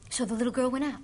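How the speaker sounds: ordinary delivery, nothing marked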